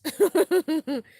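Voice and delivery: laugh. Real, not acted. Laughs at tubes joke.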